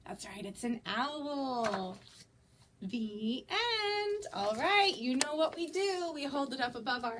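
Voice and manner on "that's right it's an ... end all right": usual speaking voice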